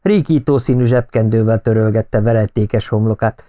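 ordinary delivery

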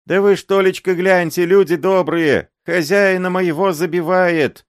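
put-on high, loud wailing plea of a beaten old man. A narrator's reading. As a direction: wail for help, voice up high. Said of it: "этот воющий голос".

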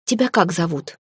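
usual speaking voice

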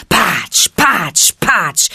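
loud punchy onomatopoeia; slapping blows. A narrator's reading. Narrator smacks out Baloo's blows, loud. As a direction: smack each word like a blow. Blow sounds.

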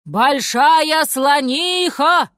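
loud, slow, drawn-out call; shouted call. A narrator's reading. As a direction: call to wake someone far away. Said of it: "крикнул лев".

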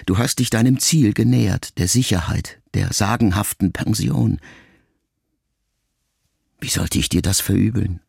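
usual speaking voice

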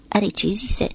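plain reading voice

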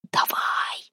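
urgent stage whisper, drawn out; whispered shout. A narrator's reading. Харапун urges Демка on after the girl, whispered shout. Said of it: "шёпотом закричал Харапун".

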